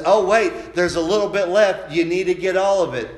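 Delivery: firm motherly call to finish it; mother to child, loud insistent reminder; mama insisting. Retold or imitated. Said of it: "And then Mama says".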